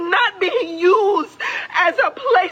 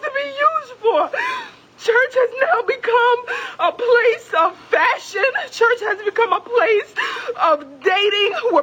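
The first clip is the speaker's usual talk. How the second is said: tearful, pitched-up outraged plea; real sobbing anger; crying out; 5 semitones higher than usual. Real, not acted. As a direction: cry it out, voice breaking and rising. Crying, outraged plea at news camera.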